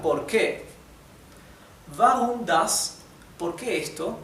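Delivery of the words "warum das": Warum das? slow, vah-room with V; slow model sentence. Shown as a demonstration. Teacher slowly models Warum das? for students. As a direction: Warum das? drawn out clearly. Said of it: "model sentence".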